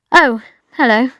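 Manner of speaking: a lightly voiced cool, distant hello; cold voice. A narrator's reading. Friend snubbing Bridget in the shop, a touch cold. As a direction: brush her off with a hint of chill. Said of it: "she said in a cold voice".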